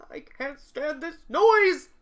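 loud, slow, high complaint; exasperated outburst. Acted. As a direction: build to a loud stretched cry on noise. Character exasperated, fleeing the noise.

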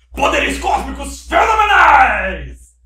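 booming, slow cosmic shout; genie voice. Pretend. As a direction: proclaim it like a genie. Gênio da lâmpada, citação. (voz de gênio trovejante)

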